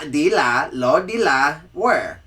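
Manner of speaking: "De La Warr" said slowly and loudly, three distinct syllables; loud, slow "Lord De La Warr"; De La Warr pronunciation. Shown as a demonstration. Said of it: "spelling check".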